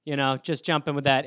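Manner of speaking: ordinary delivery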